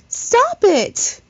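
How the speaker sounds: high, loud yelled command; piercing high loud yell. Acted. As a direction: shout it with all your will. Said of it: "Sorry, I can't yell too much".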